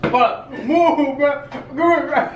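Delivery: high, laughing, urging him to move; frantic and laughing; urgent laughing. Real, not acted. Rushing friend, mouth burning.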